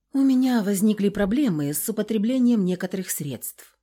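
ordinary delivery, nothing marked

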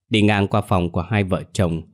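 ordinary delivery

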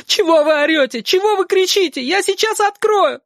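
высокий плачущий крик; кричи, срываясь на плач; со слезами. Acted. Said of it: "В голосе её были слёзы". (Сашка кричит сквозь слёзы)

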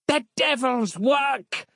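very high, drawn-out zealot's cry; high, strained mock yell. A narrator's reading. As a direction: denounce it in horror without full volume. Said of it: "yelled Harland".

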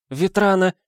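high woman's shriek; mother's high-pitched cry. A narrator's reading. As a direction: squeal the name out high. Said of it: "взвизгнула Герда Эриковна с новой силой".

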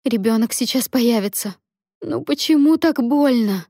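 high, distressed exclamation; pained cry. Acted. Labouring Lia feels the baby coming, crying out in pain. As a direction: climb in pitch as the pain mounts.

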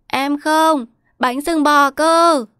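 high, loud, drawn-out whine; bawling child. A narrator's reading. Boy refusing his mother's coaxing, high wailing demand. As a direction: drag out the demand like a bratty child. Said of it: "Thấy con gào thét".

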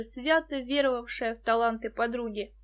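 usual speaking voice

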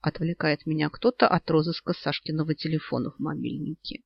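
ordinary delivery

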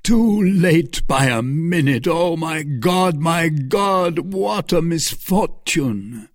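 drawn-out, high cry of grief; despairing cry. A narrator's reading. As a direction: lament with rising anguish. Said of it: "cried he".

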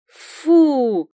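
high, loud cry of disgust. A narrator's reading. Girl recoiling from the merchant in disgust. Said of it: "с отвращением на лице произнесла".

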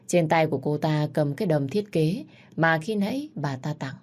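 plain reading voice

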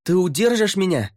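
high, strained call upward; shouted question. A narrator's reading. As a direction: yell the question up from below.

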